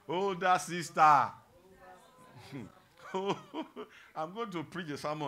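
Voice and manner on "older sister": loud, drawn-out call. Shown as a demonstration. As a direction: stretch the title out, loud and teasing. Calls out the title to congregation.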